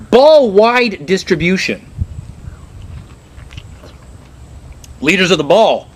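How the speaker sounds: slow, high mock announcer reading a slogan; a hammed-up official coining ball phrases. Comic to the audience, a mock official reads slogans slowly.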